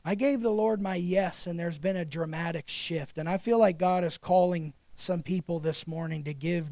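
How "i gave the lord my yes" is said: ordinary talk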